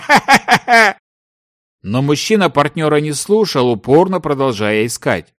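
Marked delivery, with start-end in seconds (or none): 0.1-1.0 high, acted derisive cackle; mocking laugh. A narrator's reading. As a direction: laugh loudly at his nonsense. Mocking laugh after ridicule. (издевательский хохот)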